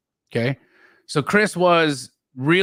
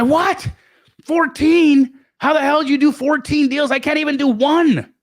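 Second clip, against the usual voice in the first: high, louder incredulous "Fourteen?"; stunned disbelief; 12 semitones higher, 3 dB louder than usual. Retold or imitated. Josiah amazed at fourteen deals, high disbelief.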